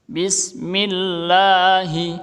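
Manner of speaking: Bismillahi slow with the lam held long; drawn-out demo. Shown as a demonstration. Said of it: "Ini tetap dibaca secara panjang".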